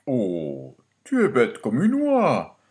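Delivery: lightly voiced chiding father; fond father. A narrator's reading. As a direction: gently scold your child as a storybook father. Father fondly chides his son.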